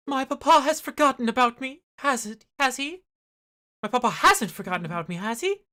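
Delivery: put-on young lady. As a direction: fret that papa forgot her. Voices Caroline, young noblewoman.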